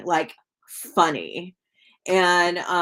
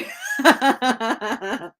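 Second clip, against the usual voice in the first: real laugh; 5 semitones higher, 3 dB louder than usual. Real, not acted. Laughing during a live reading.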